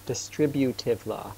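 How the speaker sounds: "distributive law" said slowly, syllable by syllable; slow down and spell out each sound of "distributive law". Real, not acted. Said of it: "Sorry, sometimes I talk a little bit fast".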